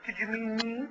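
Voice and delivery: the cat asks if it was meant, high and soft; innocent cat. Retold or imitated. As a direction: play the wounded innocent. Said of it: "she gives me that look like".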